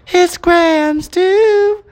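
very high, slow sing-song puppet; strained falsetto Elmo singing. Acted. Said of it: "do Elmo".

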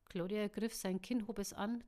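ordinary delivery

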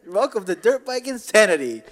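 mock radio ad. Podcaster improvising a fake dirt bike sponsor read, hyped-up ad voice. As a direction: sell cheap bikes like a hard-sell commercial.

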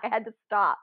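ordinary delivery

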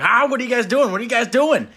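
high, excited dad catching them out; excited bursting-in. Retold or imitated. Dad bursting into the basement, imitated as excited. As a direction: pounce on them with a high-pitched question.

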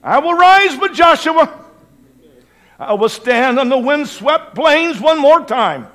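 high, loud, emphatic declaration; full-force preaching. Real, not acted. Preacher declaring his house will serve the Lord, full-force preaching. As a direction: thunder out an unshakable vow.

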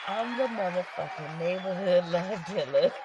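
cracking up mid-catchphrase; can't keep a straight face; giggling through the intro line; laughing through it. Real, not acted. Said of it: "I can't be serious for shit".

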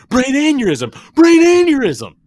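high, loud screaming. Retold or imitated. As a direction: shriek it in a panic. Acting out song character.

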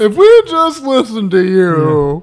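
slow, drawn-out mimicry; exaggerated, dragged-out imitation; mocking echo. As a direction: finish the sentence for them, stretched and mocking. Mockingly finishes Mom's sentence.

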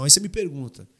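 usual speaking voice

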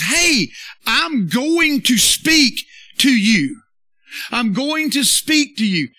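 loud, high, slow declaration as God; emphatic proclamation. Acted. Preacher voicing God to the congregation, emphatic proclamation. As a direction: call it out slowly, word by word.

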